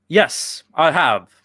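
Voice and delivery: normal talking voice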